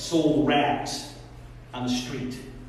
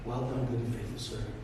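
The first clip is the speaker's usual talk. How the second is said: tender master's praise; 8 semitones lower, 12 dB quieter than usual. Retold or imitated. Master softly blessing his faithful servant, tender. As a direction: speak it gently like a proud father.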